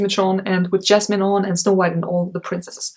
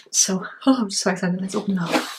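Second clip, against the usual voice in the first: ordinary delivery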